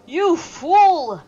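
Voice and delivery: loud, high barked insult; angry shout. Acted. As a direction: yell it at the cat.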